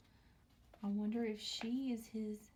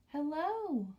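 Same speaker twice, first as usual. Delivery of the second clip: usual speaking voice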